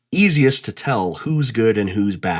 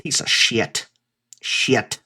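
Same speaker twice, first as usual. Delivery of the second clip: little twang; slower than usual. Shown as a demonstration. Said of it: "I said that with a little twang".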